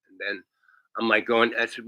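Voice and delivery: ordinary talk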